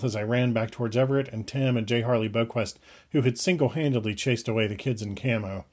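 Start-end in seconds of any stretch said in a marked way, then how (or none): none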